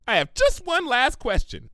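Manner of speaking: high, fast, eager pony voice; bubbly Pinky. Acted. Pinky suggesting to Twilight, bubbly and quick. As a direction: blurt out a silly idea brightly.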